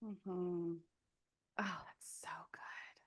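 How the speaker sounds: moved, breathless whisper. Real, not acted. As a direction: whisper it, deeply touched.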